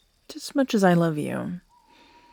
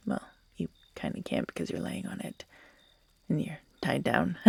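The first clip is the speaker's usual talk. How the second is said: fast, lower, unvoiced mocking whisper; teasing whisper; 4 semitones lower, 9 dB quieter than usual. Captor teasing the tied-down listener, fast mocking whisper. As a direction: tease them in a quick hush.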